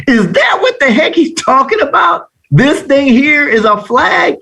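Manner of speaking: high-pitched disbelief, acted out; incredulous. Student finally seeing the flag, incredulous. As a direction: squeal it in mock outrage at the discovery. Acted astonished realization of flags.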